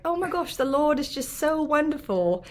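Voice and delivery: high-pitched put-on British accent. Shown as a demonstration. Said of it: "I kinda talk like a British accent".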